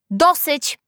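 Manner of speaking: high, loud cut-in; stern interruption. A narrator's reading. Teacher stops the quarrel, interrupting sharply. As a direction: cut them off loudly. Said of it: "przerwała nauczycielka".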